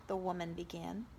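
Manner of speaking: usual speaking voice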